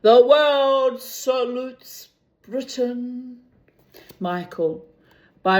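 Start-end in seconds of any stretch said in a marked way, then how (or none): 0.0-3.4 slow, booming radio announcement; announcer with echo. Acted. Announcer to the nation, slow echoing proclamation.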